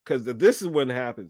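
ordinary delivery

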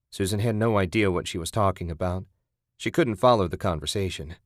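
plain reading voice

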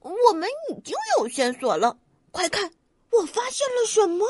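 high, eager rabbit voice; excited shout. A narrator's reading. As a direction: call out a thrilling discovery. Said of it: "兔子激动地大叫".

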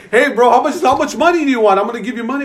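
high, loud, relieved rush; bubbling with relief and laughter; offering money to the finder, excited and high; eager. Retold or imitated.

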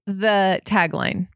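normal talking voice

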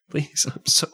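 whispered through nervous laughter; breathy embarrassed laugh. Real, not acted. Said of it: "I'm so uncomfortable right now".

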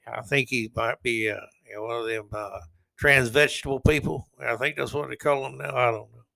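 hammed-up dim-witted Southern accent; mock stupid Southerner. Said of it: "I'm mocking a stupid Southerner".

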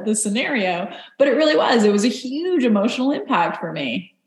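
ordinary talk, nothing marked

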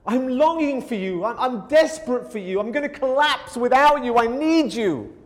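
a desperate psalmist crying out; desperate plea. Retold or imitated. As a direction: beg God as if about to collapse. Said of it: "He's like".

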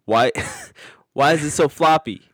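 loud mock outrage; indignant loud. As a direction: protest loudly in fake offense.

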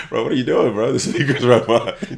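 high, loud alarmed friend; mock-shouted warning over a loud speaker; friend's alarmed voice. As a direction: yell it like a worried buddy over the music. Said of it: "this motherfucker is like".

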